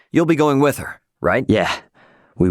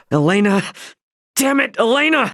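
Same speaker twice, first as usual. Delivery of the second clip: desperate calling; 11 semitones higher than usual. Acted. Hero losing contact with Elena, frantic shout. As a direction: call out in frustration.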